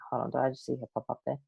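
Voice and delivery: soft, quick thinking aloud; murmured aside. Real, not acted. Host thinks she has spotted the guest, murmured aside. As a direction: mutter it quickly to yourself. Spotting Holly, talking to herself.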